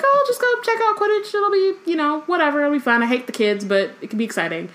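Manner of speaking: high, airy pitch settling back down; imagined Umbridge. Retold or imitated. Host imagines Umbridge casually deciding to watch Quidditch, high mock role voice. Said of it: "she was like".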